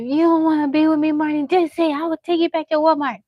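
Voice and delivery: high, loud, fast mock scolding; retold scolding. Mother retelling her threat to daughter: loud, fast scolding. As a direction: fire it off high and loud like a fed-up mom. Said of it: "I said".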